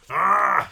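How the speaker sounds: loud growl of frustration; exasperated roar; frustrated growl. A narrator's reading. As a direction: let out a loud, exasperated "aargh". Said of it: "she growled".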